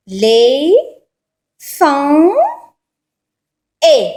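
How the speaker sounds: Les, fan, et, each loud and on its own; separate syllables. Shown as a demonstration. Teacher showing the scrambled syllables of a word, one by one. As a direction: sound out each syllable with long gaps. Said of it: "regardez les syllabes".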